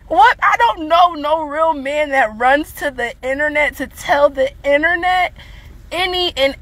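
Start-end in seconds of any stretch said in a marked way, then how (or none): none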